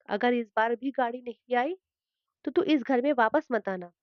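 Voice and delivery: usual speaking voice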